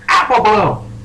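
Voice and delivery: high, loud call of a name; shouted call. Acted. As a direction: shout out to a wayward youngster. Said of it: "you hear a voice shouted".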